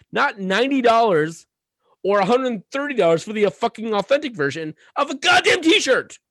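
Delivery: high, loud, furious rant about overpriced jerseys; angry rant. Real, not acted. Podcast host ranting to co-host about pricey shirts, loud and furious. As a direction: let it boil over, shouting your outrage. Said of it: "that's my rant".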